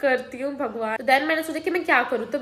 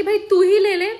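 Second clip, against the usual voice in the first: louder, higher, teasing reply; mock exclaim to a friend, pitched up; playful loud retort; 7 semitones higher, 8 dB louder than usual. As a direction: toss it back playfully to a friend. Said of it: "मैंने बोला कि".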